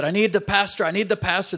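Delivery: high, loud, pleading repeat; frantic rider calling at the door; urgent plea. Acted. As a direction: beg for the pastor in a panic. Rider begging for the pastor.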